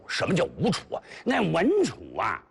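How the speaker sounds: ordinary talk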